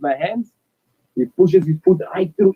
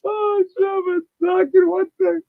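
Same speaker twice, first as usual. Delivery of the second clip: high, choked-up voice near tears; emotional; 8 semitones higher than usual. Real, not acted. Son hearing his mom's message, choked up and high. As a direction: hold back the tears as it hits you. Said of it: "Now you're gonna make me emotional".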